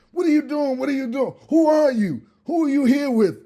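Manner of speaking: high, indignant squawk of a host; mock outraged homeowner. Homeowner yelling at the messy guest, high mock outrage. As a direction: demand who let this guest in.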